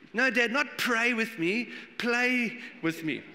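put-on high, slow childlike correction; be the small girl insisting, slowly and patiently; little daughter correcting. Preacher imitating his young daughter correcting Dad, high and childlike. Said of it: "she used to say".

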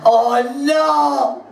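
high, loud cry of dismay; horrified reaction. Retold or imitated. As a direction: mimic a friend's horrified outcry. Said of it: "So Mary went like that".

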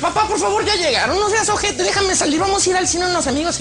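put-on very high pleading girl; mock daughter's insistent begging. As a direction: wheedle dad in a squeaky high voice. Daughter begging dad again.

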